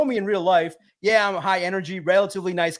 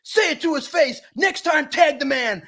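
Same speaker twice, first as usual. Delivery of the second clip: imitated yelling; 8 semitones higher than usual. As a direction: shout it like a riled-up teammate egging him on. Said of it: "C D Deuce is in the back going".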